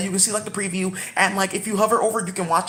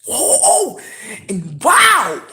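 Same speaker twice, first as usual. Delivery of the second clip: excited cries; 2 semitones higher, 4 dB louder, much slower than usual. Real, not acted. Reacting to Robin in hentai, excited cries. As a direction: blurt out surprised delight.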